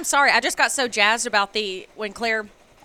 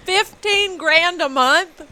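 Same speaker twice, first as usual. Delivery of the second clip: shocked; 7 semitones higher, 5 dB louder, much slower than usual. Real, not acted. Shocked at candle spending.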